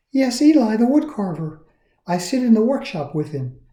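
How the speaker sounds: lightly voiced cheerful girl; Lucia voice. A narrator's reading. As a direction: make it sound simple and kind. Lucia answers.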